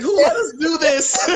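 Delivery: high, laughing mock panic; laughing disbelief. Young parent laughing at their own nerve, high and giddy. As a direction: squeal it out through a laugh. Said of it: "it's like".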